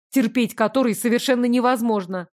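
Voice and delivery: ordinary talk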